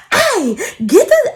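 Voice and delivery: high, drawn-out call-out; mock outburst. Girlfriend imagining confronting the girl, high mock outburst. Acting out the outburst she withheld.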